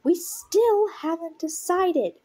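acted exasperated outburst; arguing. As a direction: argue it, stretching the words. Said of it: "He could hear the argument".

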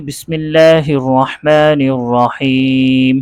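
slow, drawn-out recited invocation; intoned Arabic opening formula; Quranic recitation. Real, not acted. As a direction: recite it solemnly with long held vowels. Opening invocation recited before lesson.

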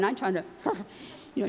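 ordinary delivery

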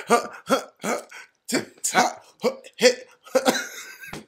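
breathy panting niece imitation; imitating niece. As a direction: mimic her breathy TikTok voice. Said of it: "she's like".

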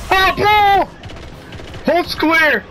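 high sharp yelp of alarm; alarmed cry. Real, not acted. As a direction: cry out as danger appears. Spots the ball in combat.

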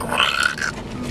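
low rumbling camel groan; grumbling camel voice. Pretend. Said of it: "That's my camel noise".

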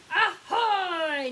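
loud, high hail with a stretched end; pirate ahoy. Shown as a demonstration. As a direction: call out for children to join in. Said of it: "One more time".

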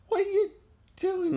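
put-on very high teasing child voice; playful high voice. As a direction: squeak it like a playful little girl. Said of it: "and she says".

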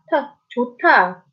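normal talking voice

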